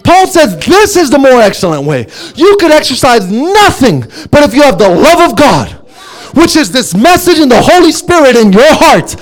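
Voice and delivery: high, driving sermon delivery; full-force preaching. Acted. Preacher to the congregation on gifts that will cease, impassioned preaching. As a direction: preach it insistently, in a high sustained pitch.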